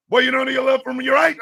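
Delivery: loud, high-pitched drill sergeant challenge. Retold or imitated. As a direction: demand an answer like a drill sergeant. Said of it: "Drill Sergeant, I know".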